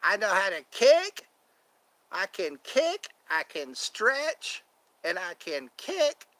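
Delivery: lightly voiced over-eager middle-aged woman; Molly Shannon character. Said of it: "She played that woman".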